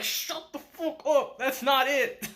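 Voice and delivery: hammed-up outraged protest; laughing retort at a friend; mock snapping back. Ko snaps back at Nazuna.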